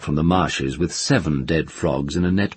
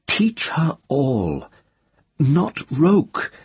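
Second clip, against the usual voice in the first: slow solemn quote; 10 semitones higher, much slower than usual. Retold or imitated. Tenar quoting old Ogion.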